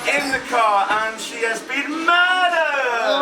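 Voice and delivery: low, dramatic host reveal; game host announcement. Acted.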